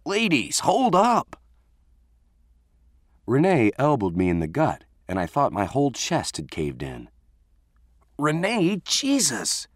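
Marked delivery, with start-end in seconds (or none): 0.1-1.4 high-pitched holler to break up a fight. A narrator's reading. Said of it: "Alex was hollering". 8.2-9.7 yelling. A narrator's reading. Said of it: "I yelled".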